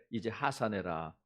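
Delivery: put-on low, slow voice of an old-tale master; martial arts master. Master sending a trained pupil down the mountain, low and slow. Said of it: "스승이 그래".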